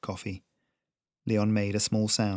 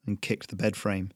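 plain reading voice

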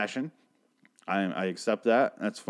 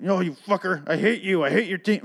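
much higher, ranting hammed-up rage; mock furious fan; 8 semitones higher than usual. Angry fan cursing out the rival team, mock fury. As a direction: play the raging rival fan. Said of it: "I don't have to be like".

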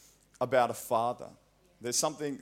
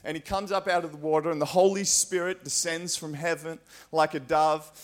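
preaching; 3 semitones higher than usual. Acted. Preacher retelling the baptism to congregation, preaching. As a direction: build the story with fervour.

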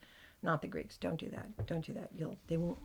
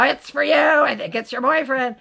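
higher, louder teasing call; sister calling out; 8 semitones higher, 14 dB louder than usual. Retold or imitated. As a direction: call her to the phone. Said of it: "my sister would put the phone down and go".

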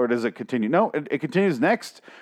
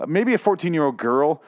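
ordinary talk, nothing marked

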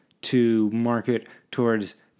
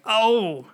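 loud, high "oh" of pain; acted stiff-neck groan; mock cry of pain; 11 semitones higher, 5 dB louder than usual. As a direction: yelp as if the neck just twinged. Acts waking with stiff neck.

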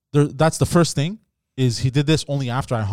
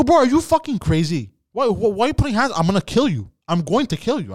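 higher, heated threat; retold furious threat; 6 semitones higher than usual. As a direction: lose your temper and threaten. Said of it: "I go".

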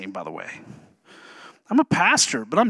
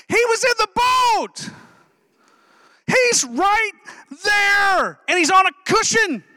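higher, ringing emphatic shout; preacher shout; 8 semitones higher than usual. Acted. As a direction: hammer home that Jesus is right there in the boat. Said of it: "I'm just kidding".

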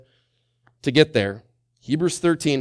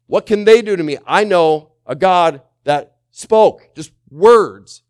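loud, high, fervent preaching; preaching at full force; 4 semitones higher, 8 dB louder than usual. Acted. Pastor rallying the church against fear, loud and fervent. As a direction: drive it home, higher and louder.

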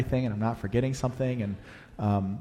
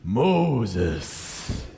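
imitating the deep movie voice of God; lightly voiced cinematic God calling a name; booming movie God voice; 3 semitones lower than usual. Pastor imitates Heston's God calling Moses, a deep movie voice. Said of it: "the voice of God in the Ten Commandments with Charlton Heston".

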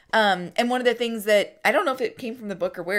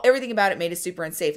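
usual speaking voice

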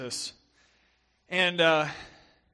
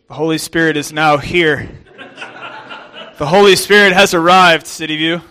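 booming playful proclamation, much louder; mock grand announcement; 16 dB louder than usual. Preacher testing the mic with a joking grand proclamation. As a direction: announce it like a herald, tongue in cheek. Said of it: "the preacher's favorite nightmare".

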